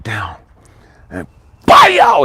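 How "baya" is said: loud, high exploding "baya"; sudden strike noise. Retold or imitated. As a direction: burst out an imitated impact, far above talking pitch. Imitates sudden cramp striking.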